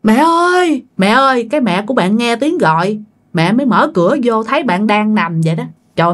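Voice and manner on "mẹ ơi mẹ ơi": calling out. Acted. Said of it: "bạn kêu".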